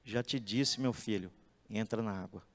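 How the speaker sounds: low, soft, patient reply; God gently answering Moses; gentle fatherly God. Acted. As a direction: calmly tell him what to do. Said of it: "Deus falou assim".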